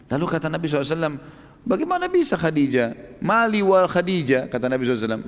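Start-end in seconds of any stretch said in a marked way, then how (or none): 1.6-4.5 higher, slow, disbelieving question; astonished at the proposal, slow and raised; incredulous surprise. Acted. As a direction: ask it as if the idea is unthinkable. Prophet amazed at proposal.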